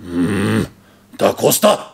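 low growled hum, then punched name; booming master's voice. Retold or imitated. Master summoning a student at dinner, booming call. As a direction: boom it out across a silent table. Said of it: "I hear this booming voice".

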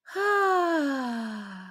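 long sigh with a catch in it; a moved, peaceful sigh. Acted. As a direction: let all the worry out in one breath. Relaxing under tree at sunset.

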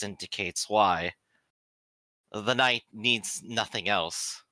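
slow, reflective, measured; humbled soldier musing slowly; slow pensive confession; thoughtful psyker. Acted. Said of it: "stops and thinks for a little bit".

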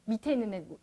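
ordinary talk

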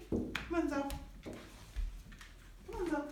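high coaxing "Come on, Zoe."; coaxing call. Real, not acted. Trainer calling dog: high coaxing. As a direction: coax the dog over, voice up.

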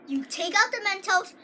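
usual speaking voice

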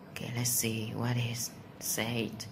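usual speaking voice

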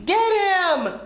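high storybook cry to the chase; excited cry. A narrator's reading. Annie to friends chasing the gecko, high cry. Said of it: "cried Annie".

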